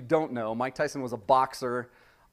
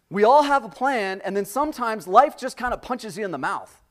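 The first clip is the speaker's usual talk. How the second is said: raised, impassioned preacher voice; fervent preaching; 8 semitones higher, 6 dB louder than usual. Real, not acted. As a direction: preach it with fire to the congregation. Preaching at full force.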